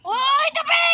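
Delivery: loud high yell; shout. Acted. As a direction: shout a warning. Shouting to get out of way.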